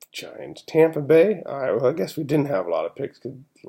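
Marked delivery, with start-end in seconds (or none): 0.1-0.6 Giants whispered, breath only. Shown as a demonstration. Repeats the team name under his breath.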